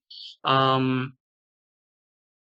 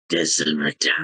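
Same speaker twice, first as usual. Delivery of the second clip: much lower, raspy undead voice; raspy corpse voice; 11 semitones lower than usual. Acted. Corpse takes your hand and addresses you, raspy. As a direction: rasp it deep, like a waking corpse. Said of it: "in a very raspy voice".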